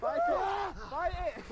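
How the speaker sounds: high, yelping shout; shrieking from the cold. Real, not acted. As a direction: cry out as icy water hits. Cold dip into icy water.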